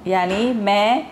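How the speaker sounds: usual speaking voice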